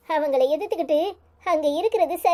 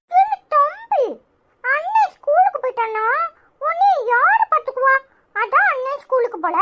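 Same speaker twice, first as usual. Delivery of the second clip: put-on children bickering; 8 semitones higher than usual. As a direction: play two kids arguing. Siblings bickering in front of mother.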